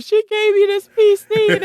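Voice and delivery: high, loud laughing exclamation. Retold or imitated. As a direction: blurt the news out, laughing in disbelief. Telling family, laughing, very high.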